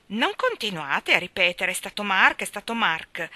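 loud, exasperated mimicry; mock her phrase with annoyance; irritated exclamation. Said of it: "esclamò Peter con una certa irritazione".

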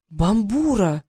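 stunned cry of recognition; shocked exclamation. A narrator's reading. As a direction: call out the name in shock. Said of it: "потрясённо воскликнул Трикс".

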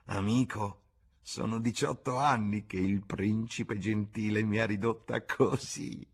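put-on bitter old woman. A narrator's reading. Bar woman telling James how she was ruined, bitter. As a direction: bitterly confess the ruin.

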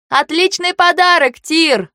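high, loud, earnest call; sincere shout. Acted. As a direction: shout the thanks with no irony. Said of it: "ответила я без всякого сарказма".

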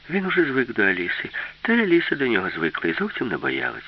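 usual speaking voice

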